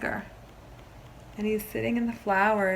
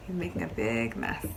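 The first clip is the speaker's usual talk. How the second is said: usual speaking voice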